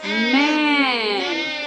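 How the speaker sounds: "मैन" drawn out very slowly; slow blended word. Shown as a demonstration. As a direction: stretch "मैन" so kids hear both sounds. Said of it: "एम का sound है म".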